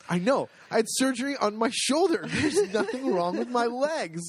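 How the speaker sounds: high-pitched, incredulous arguing; comic retold exasperation; indignant protest. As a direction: argue the obvious, pitch climbing. Retold argument with the orderly.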